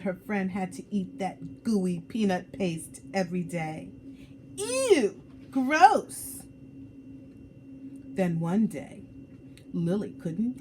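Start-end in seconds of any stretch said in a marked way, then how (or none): 4.6-6.4 high, loud ew and gross; childish shout of disgust. Acted. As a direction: squeal it out like a kid grossed out. Kid disgusted by peanut paste.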